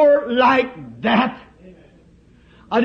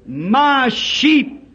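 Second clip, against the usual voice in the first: tender voice of Christ; 6 semitones lower, slower than usual. Acted. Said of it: "His voice".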